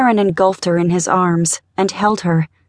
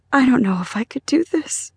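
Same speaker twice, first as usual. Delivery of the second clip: higher, tearful and faltering; voice breaking; 5 semitones higher than usual. A narrator's reading. Mother to husband after fresh shock, voice breaking. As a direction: let tears break the voice. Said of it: "Her voice broke with fresh tears".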